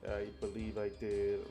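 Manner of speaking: usual speaking voice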